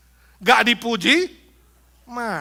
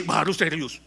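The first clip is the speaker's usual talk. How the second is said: usual speaking voice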